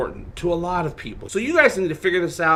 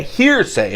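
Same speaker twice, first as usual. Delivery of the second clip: Hearsay. punched higher and louder; emphatic hearsay; 8 semitones higher, 4 dB louder than usual. Real, not acted. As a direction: hit Hearsay. hard, falling. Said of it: "this is hearsay".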